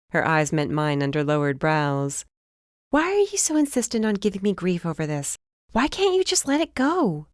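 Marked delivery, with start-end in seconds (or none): 2.9-7.3 higher, defensive younger sister; lightly voiced sister, irritated; exasperated sister. A narrator's reading. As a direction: push back on her sister, higher. Darcy voiced by narrator.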